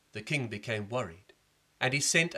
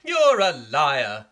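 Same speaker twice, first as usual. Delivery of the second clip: loud, slow shout; shouted accusation; 2 semitones higher, 13 dB louder, much slower than usual. Acted. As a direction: yell it at him slowly. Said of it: "everybody shouted".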